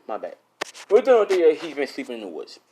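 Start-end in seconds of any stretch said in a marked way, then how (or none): none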